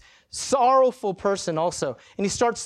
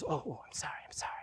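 tiny, hurried whisper; very soft, breath-only pleading; whispered apology; 15 dB quieter, faster than usual. Retold or imitated. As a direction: imitate a cowed, whispered sorry. Retold cowed apology after rebuke.

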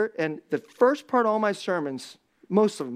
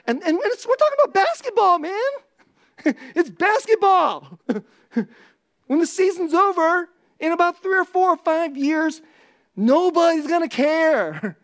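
much higher, loud, laughing exhortation; laughing fiery preaching; 10 semitones higher, 6 dB louder than usual. Real, not acted. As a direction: shout it up high through laughter.

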